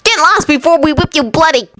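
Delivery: high mocking sneer of a bully; a bully jeering at a smaller boy, sneering. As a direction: look down your nose at the runt. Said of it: "he added".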